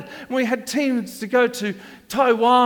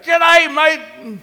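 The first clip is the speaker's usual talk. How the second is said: g'day mate shouted back, higher; loud reply; 7 semitones higher, 8 dB louder than usual. Retold or imitated. Children answering the pastor, loud reply. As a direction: yell it back with energy. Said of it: "they came back".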